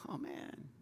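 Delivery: breathy whispered "Oh, man."; whispered sigh. Retold or imitated. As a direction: whisper it like a weary bystander. Imitating weary onlooker.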